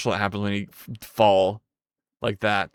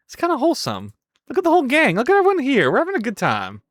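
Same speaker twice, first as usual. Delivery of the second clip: high, delighted cooing; real affectionate; gushing; 10 semitones higher, faster than usual. Real, not acted. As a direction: fawn over the chat. Greeting the many viewers.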